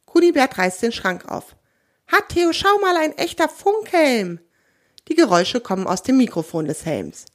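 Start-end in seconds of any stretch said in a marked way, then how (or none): 2.1-4.4 high, delighted exclamation; excited discovery. A narrator's reading. Kunibert shows Theo a radio helmet, high and excited. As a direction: show off a thrilling find, drawing out the end.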